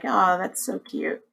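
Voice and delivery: soft cooing. Retold or imitated. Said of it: "I'm like".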